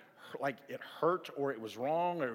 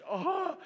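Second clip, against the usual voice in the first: high-pitched mock cry of agony; short, high imitated howl; imitated bawling wail; 14 semitones higher than usual. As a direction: wail like a kid with a burning mouth. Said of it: "he just started bawling, just crying".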